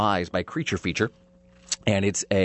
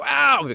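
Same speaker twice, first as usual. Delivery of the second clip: high mock yelp of pain; acted pained "ow"; 4 dB louder than usual. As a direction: yelp as if hurt, hammed-up. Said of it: "and I go".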